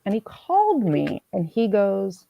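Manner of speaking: ordinary talk, nothing marked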